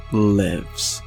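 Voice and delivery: usual speaking voice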